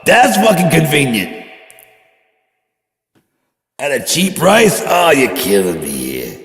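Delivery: high and loud, slow heated tirade; mock furious rant. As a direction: rant like you're personally offended. Ranting about Speedway stock.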